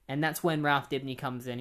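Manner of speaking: normal talking voice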